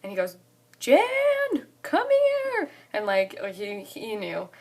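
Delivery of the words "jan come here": very high, loud holler; mock dad yelling for his wife; imitated calling out. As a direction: holler for someone in another room. Dad calls mom to phone.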